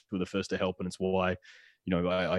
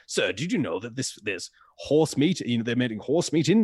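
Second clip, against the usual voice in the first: put-on English accent; 4 semitones higher than usual. Reporter questioning an abattoir worker, in an English accent. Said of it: "frighteningly good, uh, English accent".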